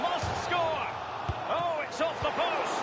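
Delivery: high-pitched cry at a near miss; excited commentary. Real, not acted. Commentator to viewers as shot hits post, excited. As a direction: call the missed chance, pitch leaping up.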